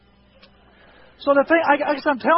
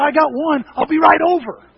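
louder, higher, keen; eager reply; 6 semitones higher, 7 dB louder than usual. Retold or imitated. Tim eagerly accepting the stump remover, loud and keen. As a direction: jump at the offer.

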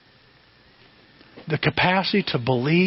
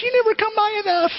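put-on much higher, nagging old-lady voice; scolding grandma. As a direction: tease him like a grandma who misses him. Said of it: "The other one says".

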